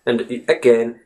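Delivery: normal talking voice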